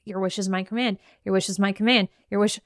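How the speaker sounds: a lightly voiced God, low and even; God granting wishes. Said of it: "God is saying".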